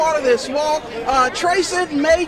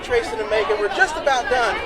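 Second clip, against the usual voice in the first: ordinary delivery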